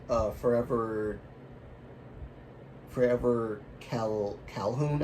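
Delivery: "Forever Calhoun" sounded out slowly, syllable by syllable, restarting on "Cal-"; slow, unsure "Forever, Forever Cal-, Calhoun"; careful pronunciation. Real, not acted. Reading out a commenter's name, pronouncing it slowly and carefully. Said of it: "I hope I said that right".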